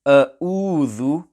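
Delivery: "A'udhu" with a plain glottal catch instead of Ain; "A'udhu" with both consonants as Hamza. Shown as a demonstration. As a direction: say "A'udhu" as "a-oodhu", no throat squeeze. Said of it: "the Ain is pronounced as Hamza".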